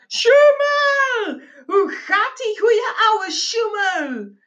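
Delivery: high, drawn-out hearty bellow. A narrator's reading. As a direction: bellow a greeting to an old pal at full chest. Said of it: "uit volle borst brulde". (uit volle borst brullen)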